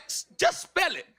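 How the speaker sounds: high-pitched imitation of her; imitated store worker. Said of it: "she took the phone back and was like".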